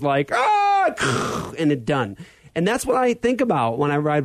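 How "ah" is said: sudden high mock yelp of terror; a quick high crash scream; short mock scream; retold scream. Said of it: "all you see is".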